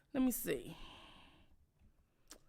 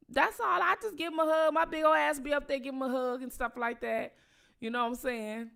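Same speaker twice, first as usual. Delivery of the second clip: ordinary delivery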